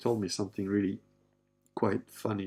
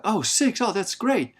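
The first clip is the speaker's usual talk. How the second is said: high, loud, gushing reaction; delighted surprise; 11 semitones higher, 8 dB louder, slower than usual. Retold or imitated. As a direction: imitate a wife exclaiming in delight. Acts wife's delighted reply to six.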